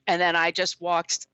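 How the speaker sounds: normal talking voice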